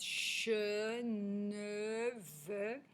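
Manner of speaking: CHE, NE, VE each drawn out slowly; isolated phonics sounds. Shown as a demonstration. Teacher announces the consonants to revise, "che, ne, ve" sustained. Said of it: "pour les consonnes".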